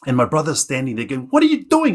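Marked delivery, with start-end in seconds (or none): 1.3-2.0 very high, loud demand; acted squawk of a question, pitched way up; alarmed older brother. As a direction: call out in disbelief at a younger brother. Brother confronts runaway in story.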